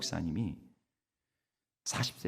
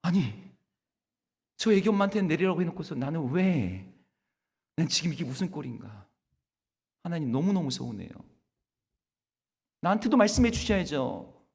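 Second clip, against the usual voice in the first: loud, high, aggrieved protest to God; hurt complaint; 7 semitones higher, 7 dB louder than usual. Retold or imitated. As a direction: let the hurt burst out at God. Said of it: "서운한 마음이 확 들어오기 시작했습니다".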